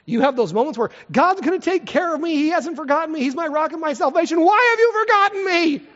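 loud, high swing from trust to anguish; voicing the psalmist. Preacher showing the congregation how grief swings, loud and pleading. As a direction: let hope crack into a cry. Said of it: "this is how it goes".

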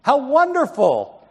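high, bright delighted cry; excited exclaim. A narrator's reading. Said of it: "the neighbor exclaimed".

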